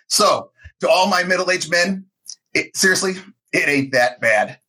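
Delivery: ordinary delivery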